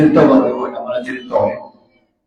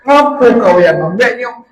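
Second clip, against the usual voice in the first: high, loud angry question; furious husband yelling down; angry shout; 8 semitones higher, 6 dB louder than usual. Acted. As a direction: confront the spirit hotly. Husband confronts jinn.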